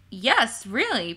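ordinary delivery

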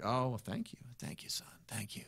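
put-on soft, weak old singer trailing toward a whisper; imitating quiet voice. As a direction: quietly imitate a frail elderly man's thanks. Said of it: "his voice was real quiet".

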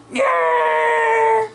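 very high, drawn-out yell; high mock scream. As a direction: wail it out long and high. Figure cries out in play.

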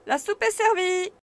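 high, loud announcement; maid's call. A narrator's reading. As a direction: call everyone to dinner. Maid announces dinner.

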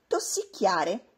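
usual speaking voice

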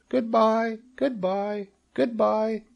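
tiny, very high farewells; small high voices calling faintly; weak distant cries. A narrator's reading. Spiderlings drifting away from Wilbur, weak high cries. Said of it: "came weakly to Wilbur's ears".

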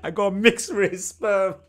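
slow, laughing through the quoted line; laughing repeat. Retold or imitated. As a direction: savour the joke line while cracking up.

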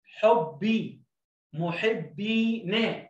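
يربي، حبي، محبينا drawn out, very slow; slow teacher read of محبينا; slow blending. Shown as a demonstration. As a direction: stretch each word for the class. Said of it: "أبي".

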